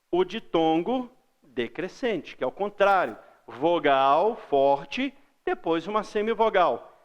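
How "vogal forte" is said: "Vogal forte" stretched very slowly; slow teaching emphasis on "Vogal forte"; emphatic drawn-out stress. Shown as a demonstration. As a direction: lean long on "Vogal" and "forte". Teacher stressing the strong vowel.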